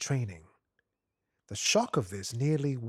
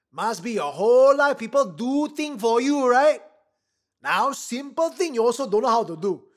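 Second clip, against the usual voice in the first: high, loud drill sergeant tirade in Singlish; furious Singlish shout; 10 semitones higher, 9 dB louder than usual. Acted. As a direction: explode at the recruit in broken English. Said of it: "Sergeant Melvin exploded into his face".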